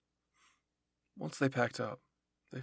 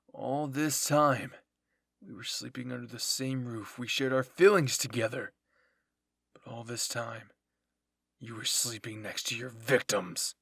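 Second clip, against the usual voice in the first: loud, hurt reproach; bitter accusation; 8 dB louder than usual. Acted. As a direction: throw the betrayal at him. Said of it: "Jean said".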